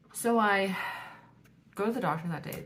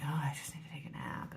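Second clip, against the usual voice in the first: tired whisper; 2 semitones lower, 7 dB quieter than usual. Retold or imitated. Retold tired thought.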